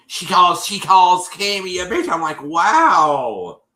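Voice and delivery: loud, high-pitched outraged disbelief; shocked retelling. Retold or imitated. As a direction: raise your voice, scandalized by the insult.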